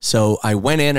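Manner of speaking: ordinary talk, nothing marked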